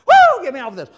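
high, loud yelp of a burned hand; the hand shrieking high and loud to get off the stove; burned hand's yelp. Acted. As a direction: yank it off the burner, crying out.

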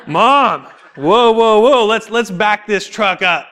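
hammed-up taken-aback son; mock protest. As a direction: halt her with exaggerated disbelief. Acts out protest to mom's advice.

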